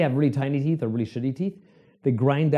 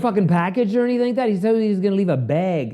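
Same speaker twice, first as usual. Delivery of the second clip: high, louder urgent question; urgent customer; 6 semitones higher, 3 dB louder than usual. Retold or imitated. Weed customer pressing the clerk about a package, high and urgent. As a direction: push the question up high, anxious. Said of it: "It's like".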